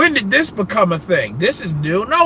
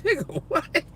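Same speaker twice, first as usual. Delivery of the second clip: incredulous reaction; 9 semitones higher, 9 dB quieter than usual. Retold or imitated. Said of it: "I'm like".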